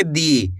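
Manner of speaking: दी low and stretched long; drawn-out dee. Shown as a demonstration. Teacher modelling दी, low and drawn out. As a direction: pull दी out slowly. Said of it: "खींच के पढ़ेंगे".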